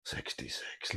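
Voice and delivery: breathy whispered score; whispering. Real, not acted. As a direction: whisper it under your breath. Muttering the score to himself.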